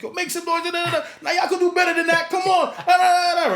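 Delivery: high, loud wedding MC shout; hyping the crowd. Retold or imitated. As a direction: re-enact a DJ rousing the room, voice pushed way up. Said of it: "I shout them out".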